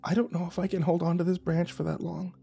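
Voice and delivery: high, very soft, frightened voice; whispered worry. A narrator's reading. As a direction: confess it in a small, high hush. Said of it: "and the cameraman whispered".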